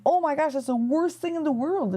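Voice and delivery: a lightly voiced, hammed-up chorus of worried friends; alarmed friends. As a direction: play the friends gasping at bad news. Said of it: "all of his friends are like".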